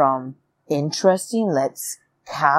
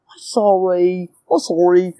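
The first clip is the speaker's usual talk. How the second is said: put-on baby accent. Vlogger mocking an evicted player's apology, baby accent. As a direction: do a silly babyish apology. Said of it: "stupid little baby accent".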